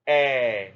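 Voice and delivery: "air" gliding toward the schwa; teacher modelling the air diphthong; centering sound. Shown as a demonstration. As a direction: let "air" slide to the centre. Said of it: "Number two".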